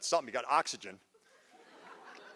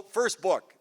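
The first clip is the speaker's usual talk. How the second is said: ordinary talk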